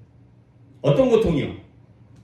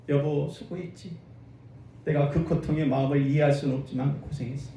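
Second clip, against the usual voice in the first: tender husband; 6 semitones lower, 9 dB quieter than usual. Retold or imitated. Husband comforting wife after birth.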